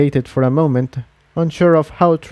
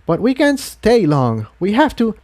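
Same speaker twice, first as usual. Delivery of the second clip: higher, hurried insistence; urgent Incendia; 9 semitones higher than usual. A narrator's reading. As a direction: press that they can't stay. Incendia insists they must leave.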